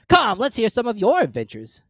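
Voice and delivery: high, loud, brisk Griffin; a loud, high-pitched beast urging on. A narrator's reading. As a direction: demand a story, impatient creature. Griffin voiced in story.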